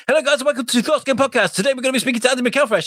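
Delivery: high, fast jittery announcer; mock hyper host. Host imitating co-host after a pot of coffee, high jittery intro. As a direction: race through it, wired on coffee.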